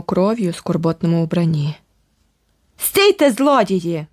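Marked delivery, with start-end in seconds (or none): 2.8-4.1 hoarse roar. Acted. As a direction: bellow it after the fleeing pair. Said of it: "почулося хрипке ревіння позаду нас".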